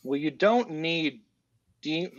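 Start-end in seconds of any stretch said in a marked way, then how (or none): none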